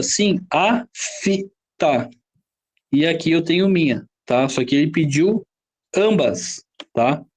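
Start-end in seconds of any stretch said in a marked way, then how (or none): 0.5-2.1 AFTA broken slowly into a-fi-ta; syllable by syllable. Shown as a demonstration. Teacher checking every letter, afta syllable by syllable. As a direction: say afta in three separate pieces, a, fi, ta. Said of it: "eu falo toda?".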